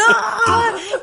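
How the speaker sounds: high, loud ecstatic "aah"; big laughing "Ah!"; elated "Ah!" cry. Retold or imitated. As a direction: cry out in bliss through laughter. Acts out techno-chasing reaction.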